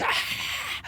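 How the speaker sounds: airy, voiceless "ah" scream; mock breathy scream. Reading out Momo's comment to the stream, mock breathy scream. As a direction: scream without any voice, just breath.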